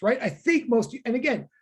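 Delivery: usual speaking voice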